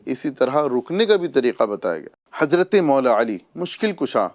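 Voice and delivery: ordinary talk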